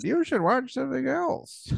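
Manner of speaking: high, prim mother voice; mock mom. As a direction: scold like a fussy mom. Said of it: "she said".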